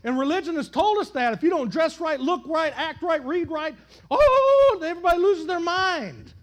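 loud, high, rising preacher's cadence; impassioned pulpit preaching; full-force preaching. Acted. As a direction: build the sermon to a shout.

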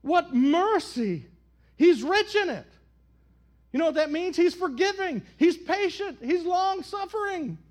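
loud, high preaching cadence; full-force preaching. Acted. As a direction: preach it out to the whole church.

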